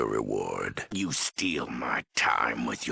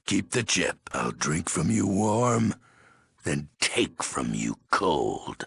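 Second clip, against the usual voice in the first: ordinary delivery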